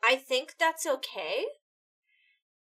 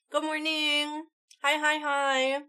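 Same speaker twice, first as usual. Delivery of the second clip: normal talking voice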